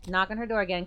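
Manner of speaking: normal talking voice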